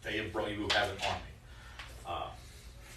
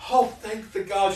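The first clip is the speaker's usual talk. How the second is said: high, loud relieved exclamation; breathless relief on meeting again; relieved cry; 9 semitones higher, 10 dB louder than usual. Acted. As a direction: gasp it out in relief. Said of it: "she goes".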